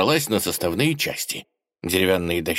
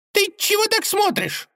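squeaky gopher. Acted. Gopher voice continues.

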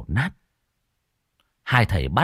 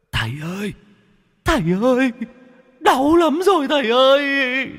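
very high, wailing ghost pleading in pain; 3 dB louder than usual. Acted. Whipped ghost begs the master, very high wailing. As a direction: cry out like a beaten spirit. Ghost begging under the lashes.

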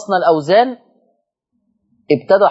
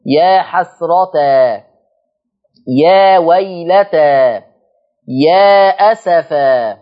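slow recited words; 4 dB louder, much slower than usual. Shown as a demonstration. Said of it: "اللي هي الاستفهامية يعني".

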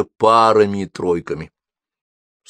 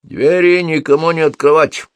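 command; 8 semitones higher, 6 dB louder than usual. A narrator's reading. Fire demon orders the door kept shut, commanding. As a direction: issue a firm, raised-pitch order. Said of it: "велел Кальцифер".

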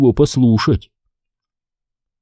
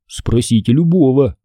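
plain reading voice

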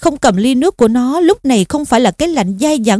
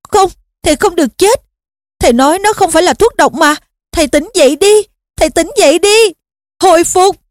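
higher-pitched, frantic pleading; desperate cry; 6 semitones higher, faster than usual. A narrator's reading. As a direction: plead urgently with someone who mustn't die. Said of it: "Harry thét lên". (kêu gào tuyệt vọng)

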